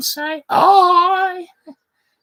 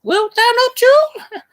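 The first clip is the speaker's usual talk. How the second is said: put-on higher and louder teasing the child; merry husband teasing; 5 semitones higher, 5 dB louder than usual. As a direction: coax the little girl, playfully high. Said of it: "quoth he".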